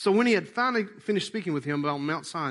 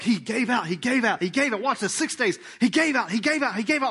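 building refrain; 7 semitones higher, 4 dB louder than usual. Real, not acted. Preacher builds repeated refrain.

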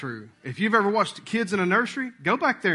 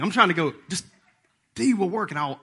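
ordinary talk, nothing marked

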